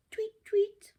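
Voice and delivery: very high whispered tweet; birdsong. Acted. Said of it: "went the birds".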